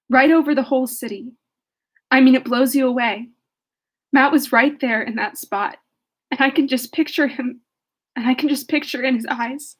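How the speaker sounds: earnest reading of a play speech; moved, earnest recital; dramatic reading. Acted. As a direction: read the monologue with feeling. Reads play's last speech aloud.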